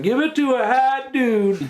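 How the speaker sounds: high, laughing mock Southern accent. Random guy in the cartoon, mock Southern accent. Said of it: "he sounded like a Southerner".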